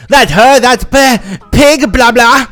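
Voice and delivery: high, loud pointing-out; loud accusation. A narrator's reading. Secret customer accuses narrator to the manager, loudly. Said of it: "Secret customer says loudly".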